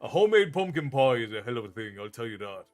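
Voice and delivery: usual speaking voice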